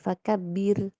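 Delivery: "fakabbir" with a thin ro; light ro. Shown as a demonstration. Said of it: "Tipiskan ya". ("fakabbir" ro tipis)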